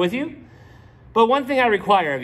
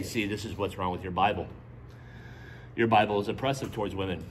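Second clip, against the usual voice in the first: low, fast, dismissive critic voice; mock critic; 10 semitones lower, 7 dB quieter, faster than usual. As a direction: rattle it off low like a sneering skeptic. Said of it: "some that will say".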